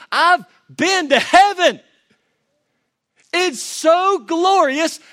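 impassioned sermon shout; preaching at full force. Acted. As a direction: preach as Paul, overwhelmed by glory. Voicing Paul, preaching hard.

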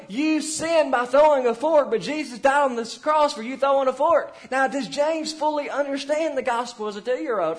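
loud, fervent sermon delivery; preaching at full force. Acted. Pastor pleading with parents from the pulpit, loud preaching. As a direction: drive it home to the congregation.

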